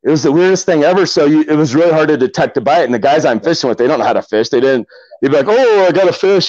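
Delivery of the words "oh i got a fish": higher-pitched imitation of an excited fisherman; mock excited angler. Guys who don't know how to fish, mock excitement. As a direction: mimic a novice thinking he has a bite. Mimics clueless anglers claiming a bite.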